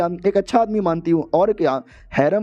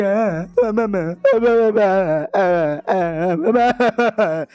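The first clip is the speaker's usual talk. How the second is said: blubbering sobs; 2 semitones higher, much slower than usual. Acted. Groom fakes a blubbering sob to get the wedding cancelled. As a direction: stutter out मैं like a blubbering crybaby. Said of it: "रोने लग जाता है".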